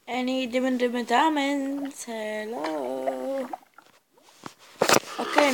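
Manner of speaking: ordinary talk, nothing marked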